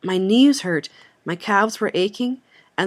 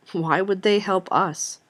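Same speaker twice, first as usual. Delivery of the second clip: ordinary delivery, nothing marked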